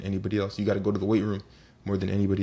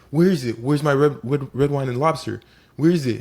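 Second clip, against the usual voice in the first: loud, higher, insistent mock demand; impatient demand; 5 semitones higher, 7 dB louder than usual. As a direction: play a pestering diner raising their voice. Impatient diner grabbing waiter again.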